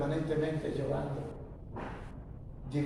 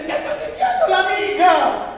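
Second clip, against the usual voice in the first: much higher, loud outraged cry; shocked scream; 13 semitones higher, 11 dB louder than usual. Retold or imitated. Wife screaming at her husband's words, shocked high cry. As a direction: shriek it in disbelief. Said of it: "Mi mujer pegó un grito".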